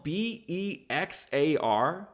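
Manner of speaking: "B E X A R" said slowly letter by letter; spelled out. Shown as a demonstration. Host spelling the Texas county's name for viewers, spelled out. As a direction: spell out "B E X A R", each letter on its own. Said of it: "It's spelled".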